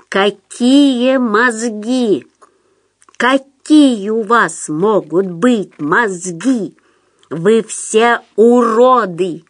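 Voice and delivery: very slow, drawn-out contempt; menacing, heavily drawled tirade; chilling menace. A narrator's reading. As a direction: stretch each word into a threat. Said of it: "Пётр говорил так, что даже моё задубевшее тело поёжилось".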